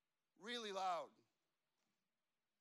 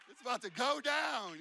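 higher, much louder mock alarm; imitated nervous panic; 5 semitones higher, 12 dB louder than usual. Nervous friend bracing for a restaurant fight, loud mock panic. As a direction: raise the alarm as a jittery defender. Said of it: "His, his little arm's shaking".

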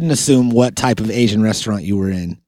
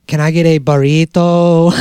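mock Mexican accent; 4 semitones higher than usual. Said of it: "a little accent".

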